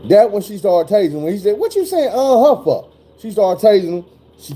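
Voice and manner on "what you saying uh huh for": high, panicky question breaking into a nervous laugh; scared pleading. Retold or imitated. Cuffed man asking the officer, high scared plea. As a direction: beg her to explain, voice climbing high.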